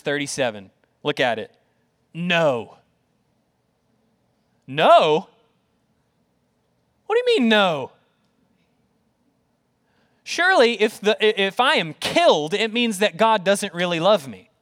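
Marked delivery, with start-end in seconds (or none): 4.7-5.3 louder, questioning echo of NO; incredulous. Acted. Preacher reacts to Paul's answer, incredulous. 7.1-7.9 higher, disbelieving challenge; incredulous. Acted. Doubter challenges Paul's answer, incredulous. 10.2-14.5 high, insistent objection; protesting. Acted. Doubter argues with Paul's conclusion, protesting. Voices a doubter arguing back.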